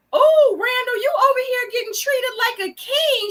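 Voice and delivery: loud, high-pitched mock amazement; mocking imitation. Host re-enacting the woman who walked up on Randall, loud mocking call-out. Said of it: "she did say it like that".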